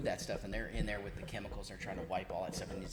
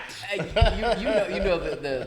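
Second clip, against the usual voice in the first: loud, high, laughing; real laughter, pitched up; 9 semitones higher, 11 dB louder, slower than usual. Real, not acted. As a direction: cut in while laughing. Jumping in laughing.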